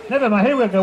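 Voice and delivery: usual speaking voice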